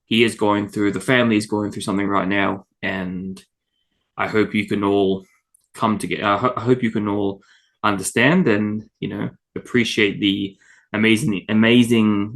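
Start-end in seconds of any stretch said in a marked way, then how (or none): none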